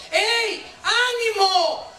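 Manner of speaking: high, slow, drawn-out preacher's shout; rousing call. Acted. The Lord's voice rouses the fallen believer, drawn-out high shout. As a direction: shout encouragement, stretching every word. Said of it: "una voz que está diciendo".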